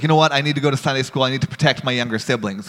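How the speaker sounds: ordinary delivery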